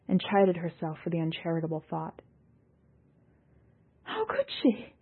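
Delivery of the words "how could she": high, crying lament; distraught. A narrator's reading. Ekarit lamenting Seon's betrayal, crying and high. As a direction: break down in disbelief. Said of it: "Ekarit said".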